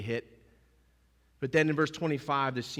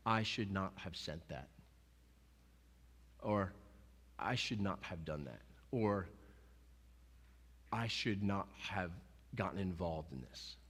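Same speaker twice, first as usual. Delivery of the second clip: regretful inner voice; 6 semitones lower, 11 dB quieter than usual. Retold or imitated.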